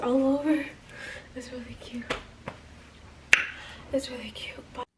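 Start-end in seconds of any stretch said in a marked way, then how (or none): 1.3-4.8 real out-of-breath whisper, much softer, slowing down; breathless whisper. Real, not acted. Exhausted after the tight shirt, telling viewers in a breathless whisper. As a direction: whisper it, barely any air left. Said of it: "I'm out of breath".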